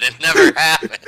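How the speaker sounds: bursting into laughter; can't hold back the laugh. Real, not acted. Cracking up at her own fanfic line, laughing hard.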